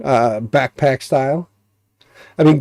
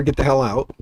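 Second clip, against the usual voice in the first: normal talking voice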